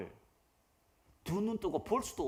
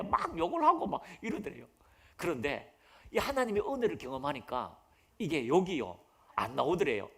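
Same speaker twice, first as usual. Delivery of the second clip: ordinary talk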